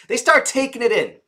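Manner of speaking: ordinary delivery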